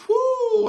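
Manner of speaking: sudden high whoo of excitement; high hype whoo. Real, not acted. Reactor hypes the bar to viewers with a high whoo. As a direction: let out a hyped whoo.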